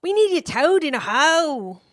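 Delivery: badly faked high Kiwi voice; mangled mock-New Zealand accent; terrible New Zealand accent. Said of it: "That was an absolutely terrible accent".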